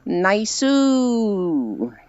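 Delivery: very long, swooping falling catchphrase; Space Fish. Retold or imitated. Showing chat how a friend says Naisu, drawn-out imitation. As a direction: drag the last vowel out and let it slide down. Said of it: "I hear it in Space Fish's voice".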